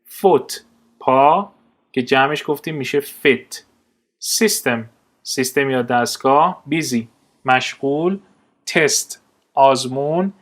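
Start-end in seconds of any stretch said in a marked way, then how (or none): none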